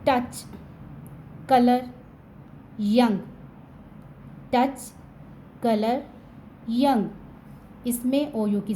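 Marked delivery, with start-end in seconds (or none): 0.1-7.1 "टच", "कलर", "यंग" each said apart with a short "अ". Shown as a demonstration. Teacher drills words where ou sounds like uh. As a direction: say them with a short uh vowel. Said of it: "अ की आवाज़ आएगी".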